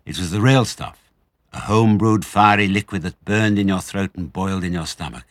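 usual speaking voice

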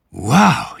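high, breathy gasp of amazement; astonished airy exclamation. A narrator's reading. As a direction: gasp it out as if winded by a strong drink. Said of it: "he gasped".

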